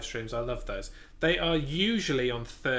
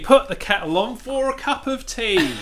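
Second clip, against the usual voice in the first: much higher, louder Cockney caricature; mock Cockney; 11 semitones higher, 10 dB louder than usual. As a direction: ham up a London cockney.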